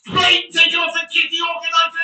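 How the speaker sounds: furious shout. Real, not acted. Streamer yelling that the game is dead, furious shout. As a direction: yell your contempt at the game.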